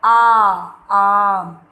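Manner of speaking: आ, आम drawn very slowly and loud; sound out the letter. Shown as a demonstration. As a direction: stretch आ, then आम, loud and slow for the class. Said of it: "अलिफ मत". (बच्चों को आ की आवाज़ ज़ोर से, धीरे धीरे)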